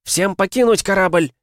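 high, loud order to abandon ship; urgent captain's cry; shouted command. A narrator's reading. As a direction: yell it out to every hand on deck. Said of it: "крикнула Миас".

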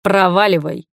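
curt threatening dismissal; mildly menacing order to leave. A narrator's reading. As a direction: send them off with a threat. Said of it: "угрожающе произнёс он".

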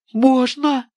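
put-on a boy who can't believe his luck; trembling boy. A narrator's reading. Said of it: "спросил парнишка дрожащим голосом". (дрожащий мальчишеский голос)

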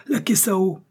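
very high, urgent order; high-pitched emergency call; urgent cry. A narrator's reading. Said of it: "s'écria le docteur".

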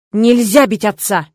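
slow, loud fatherly growl; stern, weighty scolding; growled rebuke. A narrator's reading. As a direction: lay down the law angrily. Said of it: "рыкнул папа".

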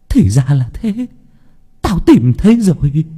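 gleeful discovery, climbing in pitch and volume; muttering turned exultant. A narrator's reading. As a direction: let the realization swell into a high exclamation. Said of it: "miệng lẩm bẩm".

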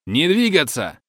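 high, loud barked order; shouted command. A narrator's reading. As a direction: yell it at the cabin. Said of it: "орёт в унисон остальным". (громкий приказ)

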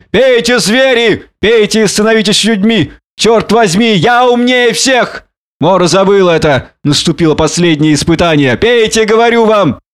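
put-on high, exultant drunken harangue; shouting. A narrator's reading. Drunken man urges beasts to drink, shouting. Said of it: "кричал Монгомери".

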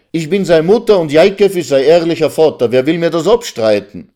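high, loud, indignant protest; a mother crying out in defence of her child; unhappy outcry. Acted. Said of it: "rief die unglückliche Resel". (empörter, lauter Ausruf einer Mutter)

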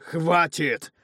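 loud, high snapping bark; barked shout. Acted. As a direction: cut him off with a roar. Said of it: "рявкнул кузнец".

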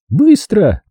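high, urgent one-word command; крик. A narrator's reading. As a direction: call out the order sharply. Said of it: "крикнула я". (выкрикнуть высоким голосом)

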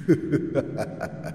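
breathy demonic cackle; an unseen ghost laughs softly; eerie ghost cackle. Acted. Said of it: "cất giọng quỷ dị".

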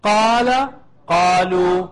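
قال، قالوا drawn out slowly, word by word. Shown as a demonstration. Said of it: "Go ahead slowly".

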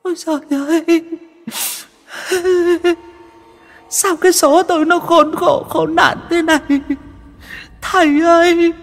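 put-on possessed old woman howling for the master to save her; wailing ghost. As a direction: sob and beg like a trapped spirit. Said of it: "ôm mặt ôm mũi mà khóc tu tu".

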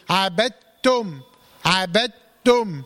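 عبدتم slowly as "abat-tum", all doubled t, no d; full assimilation. Shown as a demonstration. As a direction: recite عبدتم with a long closure on the tt. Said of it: "يبقى هنطقها كلها تاء إيه؟".

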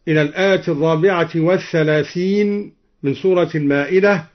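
ordinary talk, nothing marked

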